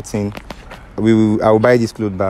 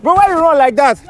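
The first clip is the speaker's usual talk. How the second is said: calling out after him; 4 dB louder than usual. Acted. Chasing after the fleeing friend.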